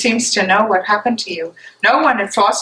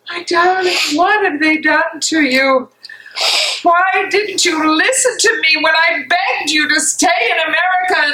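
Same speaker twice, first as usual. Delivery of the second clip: higher, pleading and aching; anguished longing; 6 semitones higher than usual. Acted. Maria grieving for her missing fiancé Dietrich, pleading and heartbroken. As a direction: cry out for him as if searching everywhere. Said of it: "looking tenderly at Bonhoeffer".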